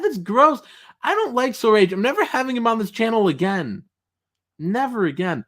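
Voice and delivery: theatrical disgust at the chat; mock disgust. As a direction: play it as fake offended. Streamer rejects co-host teasing.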